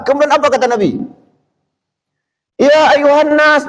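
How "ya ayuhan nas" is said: high, slow stern call; angry Prophet. Retold or imitated. Prophet scolding the loud companions in a high stern call. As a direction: call the people to order. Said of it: "Nabi marah".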